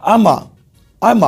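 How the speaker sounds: "I am going to" squashed into अम्मा; mock British shortening; contracted I'm gonna. Shown as a demonstration. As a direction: slur it down to अम्मा. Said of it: "I am going to बोलते हैं".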